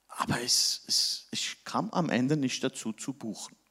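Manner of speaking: barely voiced confession; hushed whisper. Real, not acted. Pastor admitting defeat to the congregation, whispered. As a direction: drop to a near whisper, ashamed.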